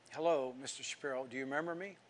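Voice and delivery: soft, careful hello; gentle greeting. Retold or imitated. Visitor at the old neighbor's door, softly. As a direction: approach an elder softly and tentatively.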